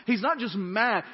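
normal talking voice

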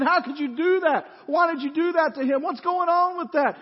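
loud, high outraged yelling at the guilty man. Retold or imitated. Studio audience yells at the unforgiving servant in outraged disbelief. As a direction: shout it like a furious studio crowd. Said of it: "They're yelling and screaming".

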